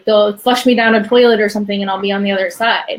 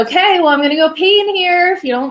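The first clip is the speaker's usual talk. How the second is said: defiant threat; 8 semitones higher than usual. Retold or imitated. Defiant threat to ignoring staff.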